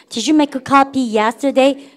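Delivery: very slow, every word spelled out for the class; teacher reads slowly so students repeat; slow model sentence. Shown as a demonstration. Teacher leads students in slow choral repeat. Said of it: "해보세요".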